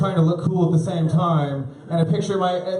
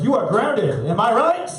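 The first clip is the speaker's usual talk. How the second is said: higher, louder cheesy comedian punchline; ground her like a hack comic; mock grounding with a comedian's tag; mock stern dad; 8 semitones higher, 4 dB louder, slower than usual. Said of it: "I was like".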